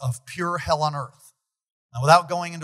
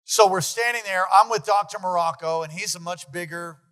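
ordinary talk, nothing marked

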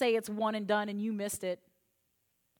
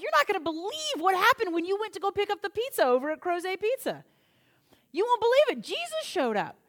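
excited news; 6 semitones higher, 3 dB louder than usual. Retold or imitated. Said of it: "it's like".

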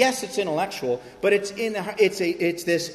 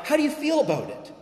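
much higher, overly polite pretend voice; mock tentative asking; 12 semitones higher than usual. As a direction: ham up a timid permission-seeker. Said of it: "I'm not like".

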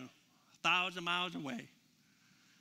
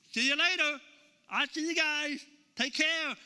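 breezy, higher and louder send-off; casual goodbye; 5 semitones higher, 5 dB louder than usual. Retold or imitated. Jesus to his disciples while rising away, breezy higher goodbye. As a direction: toss off a cheerful so long.

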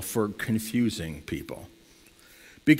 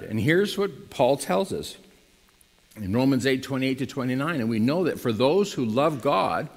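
ordinary talk, nothing marked

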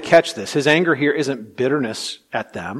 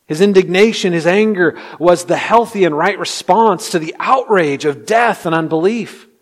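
loud, higher-pitched, urgent sermon voice; forceful pulpit crescendo; impassioned preaching; 6 semitones higher, 8 dB louder than usual. Real, not acted. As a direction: drive the point home with fervor.

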